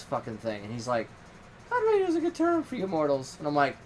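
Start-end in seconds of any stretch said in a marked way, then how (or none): 1.7-3.4 much higher put-on god voice; a haughty god talking down to mortals; imitating Loki. Loki explaining God Radio to a mortal, in a high lofty put-on voice. Loki's reply in retold chat.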